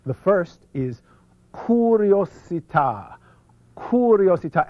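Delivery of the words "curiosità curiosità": drawn-out, higher, emphatic Italian; Italian accent. Shown as a demonstration. Speaker introducing a principle to an audience, big Italian accent. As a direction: relish each syllable in an Italian accent. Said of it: "with your best Italian accent".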